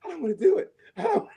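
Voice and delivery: high, anxious whine; half-laughing dread; panicky whine. Retold or imitated. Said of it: "I was like".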